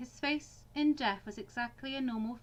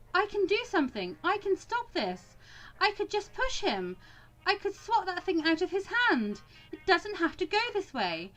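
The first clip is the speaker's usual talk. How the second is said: higher-pitched, urgent inner pleading; desperate pleading; 6 semitones higher than usual. Acted. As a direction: plead helplessly to stop what's coming. Desperate inner plea before shooting.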